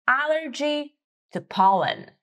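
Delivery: ordinary talk, nothing marked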